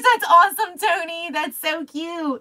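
high-pitched, tickled reaction; delighted gushing. Real, not acted. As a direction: gush over something cute. Gushes at a viewer's comment.

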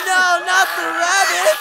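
high, crying plea; begging in terror; terrified cry. Acted. Fluttershy confronted by the rabbits, terrified crying plea.

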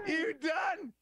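sudden high yelp of pain mid-exam; pained shout. Retold or imitated. Patient on the table yelping at the doctor, high pained shout. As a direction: cry out as the finger goes in. Agonised cry during the exam.

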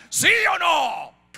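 high, loud, drawn-out demand; demanding shout. Acted. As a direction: press hard for an answer, slowly. Rey exige respuesta a profetas. (grito exigente)

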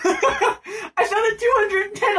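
laughing. Real, not acted. Relieved laugh at file size.

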